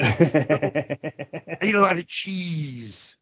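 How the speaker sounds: high, very drawn-out comic whine; mock offended complainer. Host voicing a woman compared to a refrigerator, mock indignation. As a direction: stretch it out as a hammed-up complainer. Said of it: "people are writing the show to complain".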